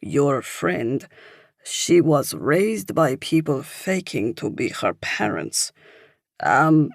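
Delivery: put-on French-accented, searching for the word; older Frenchman explaining in halting English; thick French accent. A narrator's reading. Older man translates an article for a stranger, thick French accent. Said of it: "he says with a thick French accent".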